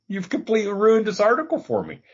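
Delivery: high, quick protest through laughter; laughing complaint. Real, not acted. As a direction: complain while cracking up. Laughing complaint to derailing co-host.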